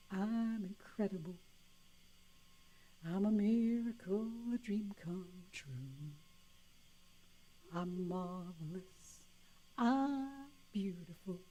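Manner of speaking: soft, slow affirmations, sinking quiet; quiet self-affirmation. Retold or imitated. Listener affirming their own worth, softly and slowly. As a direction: savour each word as a gift to yourself. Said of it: "you will think".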